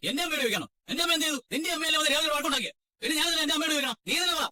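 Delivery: indignant, high-pitched questioning; angry retort. Acted. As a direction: throw the accusation back, pitch way up. Retorting in a family quarrel. (രോഷത്തോടെ തിരിച്ചു ചോദിക്കുന്നു)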